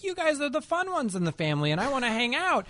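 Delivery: put-on bubbly kids running up; excited kids. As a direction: nieces and nephews chattering excitedly. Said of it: "nieces and nephews just run up".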